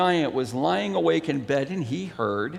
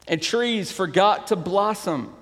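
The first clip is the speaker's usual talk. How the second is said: ordinary talk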